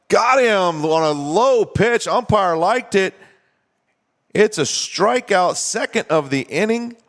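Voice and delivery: high, drawn-out play-by-play call; real excitement, pitched well up and drawn out; excited strikeout call. Real, not acted. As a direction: stretch the big call out high and slow. Announcer calls a strikeout live.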